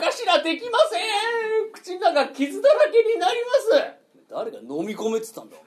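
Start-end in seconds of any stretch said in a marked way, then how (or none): 0.0-3.5 high, slow, tearful whimper; whimpering cry. Acted. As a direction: whine through the tears. Blade held in mouth.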